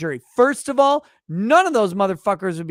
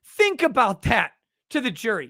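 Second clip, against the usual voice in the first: ordinary talk, nothing marked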